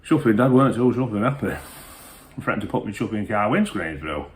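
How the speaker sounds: ordinary talk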